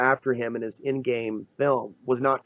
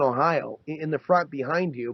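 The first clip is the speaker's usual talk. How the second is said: ordinary talk, nothing marked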